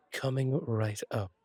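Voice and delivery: normal talking voice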